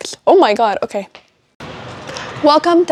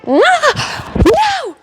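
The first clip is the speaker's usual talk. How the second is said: 1 startled cry. Real, not acted. Outburst during blooper take.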